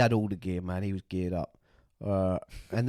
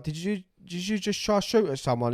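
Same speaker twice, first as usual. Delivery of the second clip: incredulous question; 8 semitones higher than usual. Retold or imitated. Asking gunman, voice up.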